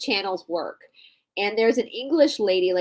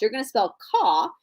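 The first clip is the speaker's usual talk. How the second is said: normal talking voice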